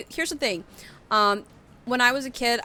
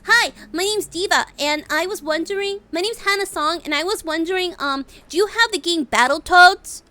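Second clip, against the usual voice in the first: put-on high, bright cutesy girl voice; Diva voice; 9 semitones higher, 8 dB louder than usual. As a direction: call in like a sweet gamer girl. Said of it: "Diva calling at GameStop".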